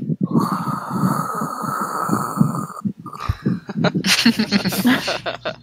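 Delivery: long, eerie imitated moan breaking into laughter; Oathbreaker moan. Host imitating the horrible sound Oathbreakers make, eerie moan. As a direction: moan like a weird creature, then crack up. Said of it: "pretty good impression of it".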